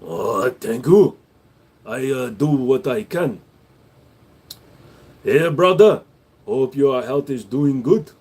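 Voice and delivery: slow, hammed-up Japanese accent; put-on Japanese accent. Shown as a demonstration. Showing off for viewers, slow mock Japanese accent. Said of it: "I do an excellent Japanese".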